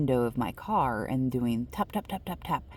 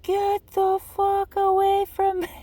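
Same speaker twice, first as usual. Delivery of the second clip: put-on much higher, slow furious yell again at the old lady; 5 dB louder than usual. Yelling at the apologizing old lady, furious yell. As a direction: bellow it to scare her off. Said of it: "I just yelled it again".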